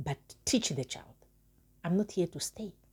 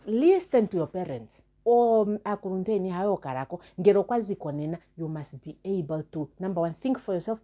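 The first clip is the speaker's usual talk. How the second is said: normal talking voice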